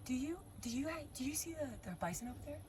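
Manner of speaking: soft, high, halting imitation of a stranger; imitated hushed, hesitant question; mock very calm. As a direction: ask softly and high, feigning calm. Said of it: "very calmly".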